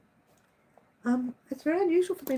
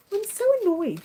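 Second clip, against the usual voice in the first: annoyed; 9 semitones higher than usual. Real, not acted. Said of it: "You know when I get annoyed".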